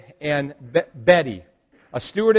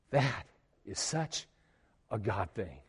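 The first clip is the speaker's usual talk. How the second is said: tearful; 8 semitones lower, 13 dB quieter, slower than usual. Retold or imitated. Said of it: "Tears started coming down her face".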